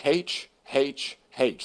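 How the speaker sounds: slow, separate "haitch" for each "H"; aspirated H. Retold or imitated. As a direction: repeat "H" deliberately as "haitch". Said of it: "Could you say that again?".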